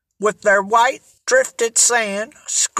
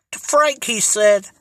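plain reading voice

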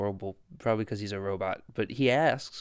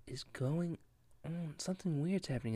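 hushed wondering; 4 semitones higher, 9 dB quieter than usual. Retold or imitated. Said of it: "she's wondering".

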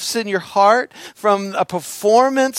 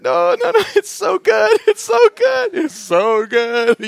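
high, loud mock wailing; hammed-up happy sobbing through the words; crying hard, imitated; 13 semitones higher, 7 dB louder than usual. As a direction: wail it way up high, overcome with joy. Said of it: "I'm, like, crying so hard".

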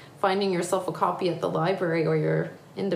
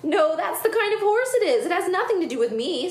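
high, quick protest; girl character; 11 semitones higher, 3 dB louder than usual. Acted. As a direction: set them straight as a kid. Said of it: "said Lucy".

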